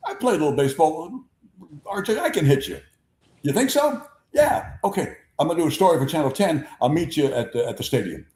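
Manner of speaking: high, rising playful bragging; Hal Clement's banter. Retold or imitated. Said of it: "Hal Clement starts the same thing".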